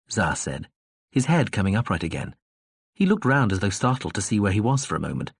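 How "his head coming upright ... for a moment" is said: usual speaking voice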